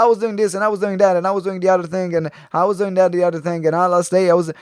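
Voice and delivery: rattled off fast without pauses; rushed talk demo. Shown as a demonstration. Coach showing a rushed story, rattled off fast. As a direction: gabble it a thousand miles an hour. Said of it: "a thousand miles an hour".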